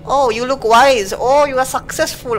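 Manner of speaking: high, slow, loud fawning praise; gushing flatterer. Acted. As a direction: lay the flattery on thick.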